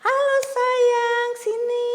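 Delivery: very high, long-drawn singsong call; high-pitched kindergarten teacher greeting; talking to little children. Acted. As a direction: coo loudly to a small child to come over. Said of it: "kita ngomong kan gitu terus".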